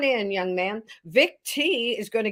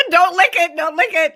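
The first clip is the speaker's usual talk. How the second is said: loud warning; 9 semitones higher, 10 dB louder than usual. Real, not acted. Warning friend off licking statue.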